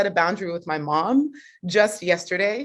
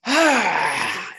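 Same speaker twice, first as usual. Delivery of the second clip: letting it out. Retold or imitated. Daughter on mute venting before setting a boundary, long sighed release. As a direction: let out a drawn-out venting sigh. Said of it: "I just, I let it out".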